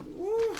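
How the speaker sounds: high cooing "whew"; swooning whew. Real, not acted. Fan cooling off over Mister Russell, swooning whew. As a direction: overcome with attraction, a high whew. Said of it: "I just had to fan myself".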